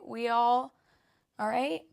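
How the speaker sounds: playful excited. Real, not acted. Joking demand, laughing.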